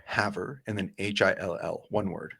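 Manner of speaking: ordinary delivery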